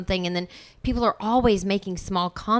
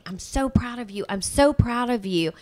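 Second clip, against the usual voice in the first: retold shout; 5 semitones higher than usual. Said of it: "just shouting".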